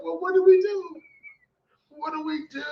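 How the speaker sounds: pleading in a panic; panicky high plea. Retold or imitated. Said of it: "So I was like".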